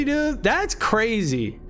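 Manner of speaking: ordinary talk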